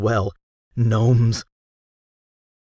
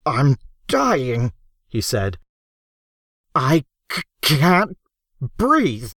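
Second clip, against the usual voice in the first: gasping for breath; 4 semitones higher, slower than usual. Acted. Man gasping he can't breathe.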